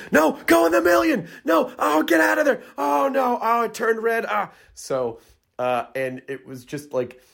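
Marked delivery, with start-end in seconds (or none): 0.1-4.5 high excited shouting at players; game show shouting. Retold or imitated. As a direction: yell at the contestant, pitched way up. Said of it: "I've literally been shouting".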